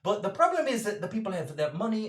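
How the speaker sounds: high, quick, earnest explaining; eager Birdie. Acted. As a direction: pile on details with nervous enthusiasm. Birdie again defends her setting.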